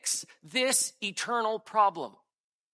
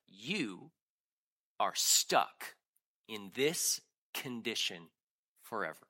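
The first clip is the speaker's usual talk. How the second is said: hushed despair; 9 semitones lower, 6 dB quieter, slower than usual. Acted. Scroll's verdict voiced in despair.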